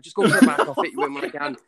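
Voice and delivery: hammed-up barber shouting through laughter; retold exasperated call. Barber sending customer to the back, mock exasperated shouting.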